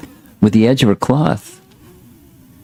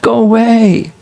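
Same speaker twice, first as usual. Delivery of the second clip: frantic yell; 13 semitones higher than usual. Acted. Yashoda yelling at the supposed witch, high frantic shout. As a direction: cry out to drive the witch away. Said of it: "Yashoda became bewildered and yelled".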